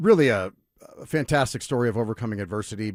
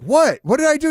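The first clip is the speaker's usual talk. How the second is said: high, loud flustered protest; alarmed yell; 8 dB louder than usual. Retold or imitated. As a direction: yell it, caught off guard. Confused self in retold scene.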